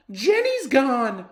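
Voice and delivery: high, loud, slow panicked yell; girl shouting in alarm. Acted. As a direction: cry out for the lost snake. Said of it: "Emily shouts".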